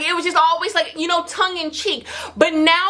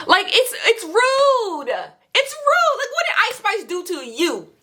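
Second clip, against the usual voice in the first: indignant; 7 semitones higher than usual. Real, not acted. Angry about the [name] diss.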